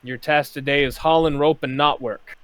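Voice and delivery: loud bosun assigning work; bosun giving orders. Acted. Master Scourge assigning the gnome rope work, loud bosun order. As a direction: bark out the task to the swab. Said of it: "and he says".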